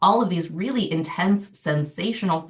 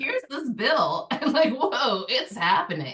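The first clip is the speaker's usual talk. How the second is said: amused awe; 5 semitones higher than usual. Retold or imitated. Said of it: "It's like".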